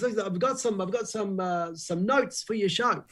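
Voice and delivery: high-pitched, insistent caller; put-on phone voice, high; Paul Holmes. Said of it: "And he goes".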